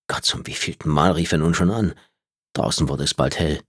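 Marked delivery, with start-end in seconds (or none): none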